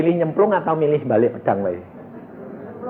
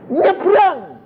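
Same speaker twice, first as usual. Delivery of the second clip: commanding call; 4 dB louder than usual. Acted. Moses orders crossing.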